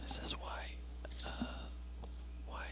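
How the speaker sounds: hushed whisper so others can't hear. Real, not acted. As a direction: whisper it secretly. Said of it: "Why are we whispering?".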